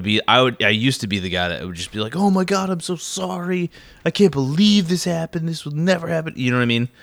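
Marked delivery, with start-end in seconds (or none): 2.1-6.3 over-apologetic voice. Retold or imitated. Imitating his old apologetic self.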